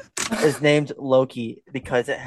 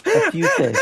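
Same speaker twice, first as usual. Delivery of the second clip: squeaky high voice; slower than usual. Acted. Repeated in squeaky voice.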